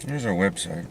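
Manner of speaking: ordinary talk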